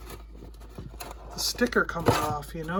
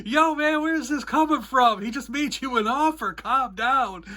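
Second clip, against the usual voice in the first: bystander cutting in; 6 semitones higher than usual. Retold or imitated. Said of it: "I hear him go".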